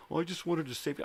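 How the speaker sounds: mocking imitation. Painter mimicking the boss's excuse, mocking high voice.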